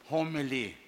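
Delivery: normal talking voice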